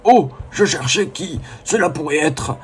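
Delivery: slow, put-on professor voice; Cosmo's Catastrophe voice. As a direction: imitate a youtuber's professor voice. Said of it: "une voix à Professeur Catastrophe que j'arrive à peu près à refaire".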